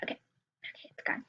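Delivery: breathy whisper after a held sneeze; barely voiced, recovering. Real, not acted. Sneeze just passed, whispering.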